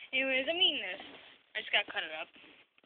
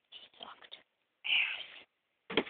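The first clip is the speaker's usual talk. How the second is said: whisper. Real, not acted. Gossipy aside about her.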